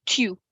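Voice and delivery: tu with rounded French u. Shown as a demonstration. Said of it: "In French, we say".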